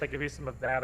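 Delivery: ordinary talk, nothing marked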